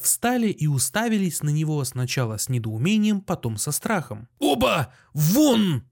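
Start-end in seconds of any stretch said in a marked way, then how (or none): 4.4-5.8 high, punched one-word commands; frenzied shouting. A narrator's reading. Doctor throws both visitors out, beside himself. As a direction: order them out in a fit. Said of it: "продолжил кричать Андрей Ефимыч".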